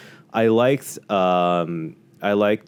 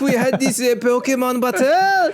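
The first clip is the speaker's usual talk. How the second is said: very high, loud flamboyant French voice; French accent; 5 dB louder than usual. Retold or imitated. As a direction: play it like an over-the-top French chef. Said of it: "Jacint is like".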